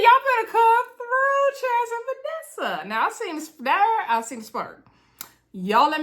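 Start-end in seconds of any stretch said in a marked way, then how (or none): 0.0-2.9 excited cheer. Real, not acted. Vlogger hyping up Chaz and Vanessa, excited cheer. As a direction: cheer them on like a hype woman.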